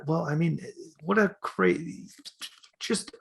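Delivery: ordinary talk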